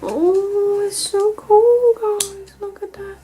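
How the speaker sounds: high, slow gushing squeal; high delighted squeal over a gift. Real, not acted.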